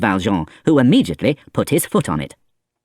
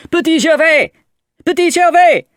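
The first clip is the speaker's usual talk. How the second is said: high, loud calling out; shouting; 7 dB louder than usual. A narrator's reading. Valjean running along the path, shouting the boy's name. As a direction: call across the dark fields.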